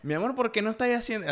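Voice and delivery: high-pitched partner impression with a Maracucho lilt; lightly mock partner's Maracaibo accent; teasing take on borrowed Zulia accent; imitated Maracucho accent. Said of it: "Así me decís".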